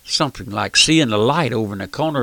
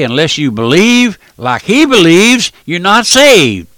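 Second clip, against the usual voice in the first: mock preachy proclamation; 6 semitones higher, 8 dB louder, slower than usual. They rise up and say.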